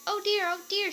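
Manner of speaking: high, alarmed little cry; scared cry. A narrator's reading. Goldilocks waking to three bears, crying out in fright. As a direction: panic like a startled girl. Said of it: "she cried".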